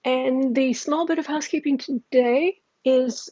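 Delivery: ordinary delivery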